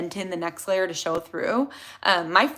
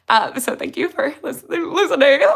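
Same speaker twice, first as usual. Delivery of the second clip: laughing through tears; 9 semitones higher, 6 dB louder than usual. Real, not acted. Tearful thanks, laugh and cry.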